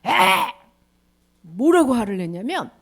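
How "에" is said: loud annoyed huff; angry scoff. Acted. Angry people scoffing at God, huff. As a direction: scoff in irritation.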